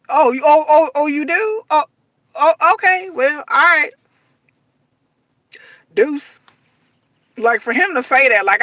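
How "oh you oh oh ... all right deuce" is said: sarcastic oh okay. Pretend. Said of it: "I would look at him like".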